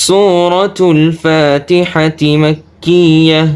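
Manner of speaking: slow, drawn-out melodic recitation; chanted Quranic tilawat. Shown as a demonstration. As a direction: recite slowly with long held vowels.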